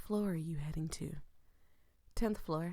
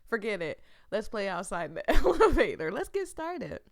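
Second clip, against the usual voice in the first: playful laughing quote; 4 semitones higher, 8 dB louder than usual. Retold or imitated. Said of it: "So I was like".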